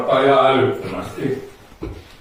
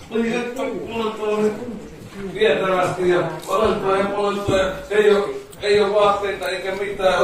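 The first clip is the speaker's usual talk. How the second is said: a touch higher, coaxing suggestion voice; hypnotist patter; 4 semitones higher than usual. Acted. Hypnotist urging volunteers to huddle for warmth, coaxing patter. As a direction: egg them on to shiver.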